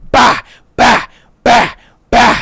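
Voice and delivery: punchy burst of mock blows; imitated punch sounds. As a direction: sound out each blow as it lands. Imitates punches landing in a beating.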